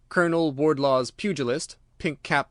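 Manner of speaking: usual speaking voice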